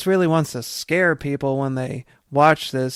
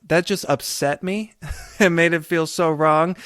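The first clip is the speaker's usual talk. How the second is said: ordinary talk, nothing marked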